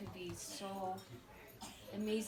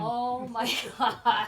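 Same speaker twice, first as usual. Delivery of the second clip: much louder, slow, laughing through it; 3 semitones higher, 11 dB louder, slower than usual. Real, not acted. Recalling the wild drive with Justin, laughing. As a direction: break up laughing at the memory.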